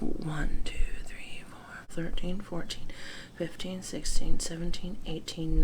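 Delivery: counting softly under breath; whispered counting. Real, not acted. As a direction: whisper the numbers quickly.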